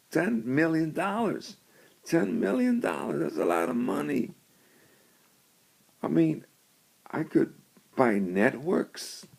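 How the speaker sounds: rising high and eager over the money, lightly voiced; dreaming big about ten million dollars; greedy excitement. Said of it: "she thinks to herself".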